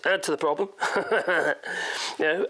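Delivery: usual speaking voice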